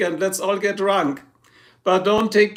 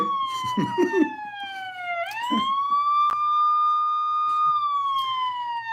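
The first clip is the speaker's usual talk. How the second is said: shrill falsetto giggle; a high squealing laugh; squeaky high laugh; 5 dB quieter than usual. Real, not acted. Streamer giggles high after joking about police.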